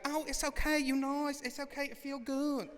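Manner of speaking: high-pitched, reassuring salon worker voice; nail salon worker. Retold or imitated. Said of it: "And they're like".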